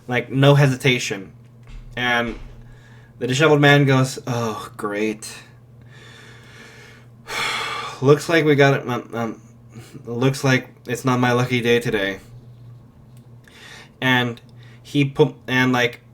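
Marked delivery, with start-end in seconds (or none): 4.3-12.2 unimpressed sigh at bad luck; weary sarcasm. Weary hero sees monster charging.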